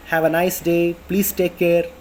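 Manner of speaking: usual speaking voice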